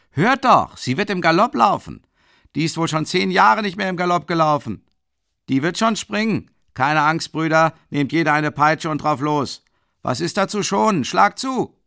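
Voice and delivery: put-on high, loud drunken yelling; rowdy shouting. A narrator's reading. Mikolka urging his friends to beat the horse, shouting. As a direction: whip the crowd up. Drunk carter egging on crowd.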